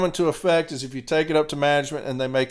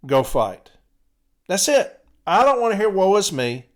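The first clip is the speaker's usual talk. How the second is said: raised, higher-pitched telling-off; exasperated scolding; 3 semitones higher, 4 dB louder than usual. Real, not acted. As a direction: order them to quit whining and fight. Scolding listeners to stop whining.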